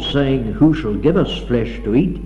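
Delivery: ordinary talk, nothing marked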